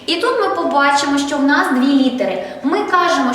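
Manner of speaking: ordinary talk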